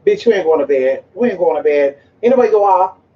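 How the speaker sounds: ordinary talk, nothing marked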